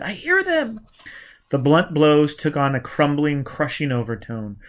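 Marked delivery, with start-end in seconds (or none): 0.0-0.8 a much higher frightened woman; Giz. A narrator's reading. Giz telling Gully pursuers near. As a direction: pitch it high with fear. Giz's voice.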